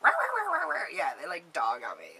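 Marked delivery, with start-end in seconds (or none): 0.0-0.9 very high mocking rah rah babble; mock jealous chatter. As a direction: parody their complaining noise.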